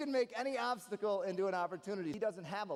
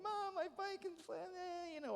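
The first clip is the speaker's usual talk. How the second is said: put-on childish crying whine; 13 semitones higher, 5 dB quieter than usual. Boy crying to his mom about a flat tire. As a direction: whine it like a crying eight-year-old. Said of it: "And I'm crying".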